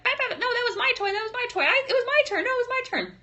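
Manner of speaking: put-on very high childish squabbling; bickering kids. Kids squabbling while mom loads the car. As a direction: whine like siblings fighting over a toy. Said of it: "they were like".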